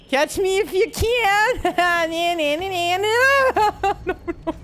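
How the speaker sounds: high giddy teasing with laughter; mock the monster, half laughing; taunting laugh. Real, not acted. Taunting pursuer while fleeing.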